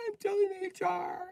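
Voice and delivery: high whiny tattle. Acted. Capricorn whines reply.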